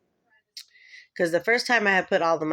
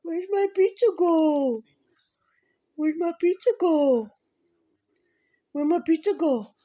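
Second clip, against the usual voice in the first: high, slow, whiny puppy voice; dog's voice; 13 semitones higher, slower than usual. Pretend. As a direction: voice the dog searching for pizza. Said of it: "She's like".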